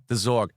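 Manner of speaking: "Zorg" said with a clear R and hard G; Zorg correct name. Retold or imitated. Correcting the ship's name in the retold exchange: "Zorg" with R. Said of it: "the R in script looked like an N".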